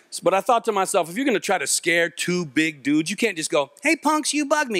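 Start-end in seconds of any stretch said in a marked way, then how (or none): 3.8-4.8 high-pitched, feeble mock threat; weak threat. Shown as a demonstration. Showing how not to scare two big dudes, high weak threat. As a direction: pipe it up high, unconvincing tough talk. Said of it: "can't just say it like that".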